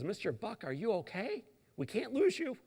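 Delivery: a hint of a pleading nurse; gentle concerned nurse; worried nurse. Nurse voiced, higher.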